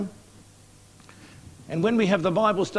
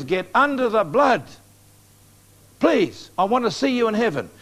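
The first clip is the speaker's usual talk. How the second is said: urgent plea; 4 semitones higher, 3 dB louder than usual. Retold or imitated. Pleading with guide after yell.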